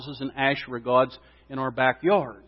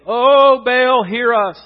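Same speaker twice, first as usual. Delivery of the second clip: loud, raised plea to Baal; crying out to a god; 7 semitones higher, 8 dB louder, much slower than usual. Acted. Prophets calling on Baal for hours, crying out. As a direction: call out high and drawn to the heavens.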